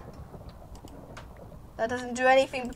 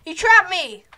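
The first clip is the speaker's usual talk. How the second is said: louder, higher alarmed telling; surprised alarm; 6 semitones higher, 11 dB louder than usual. Real, not acted. Player telling viewers Red Brain trapped him, surprised and loud. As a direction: sound caught off guard.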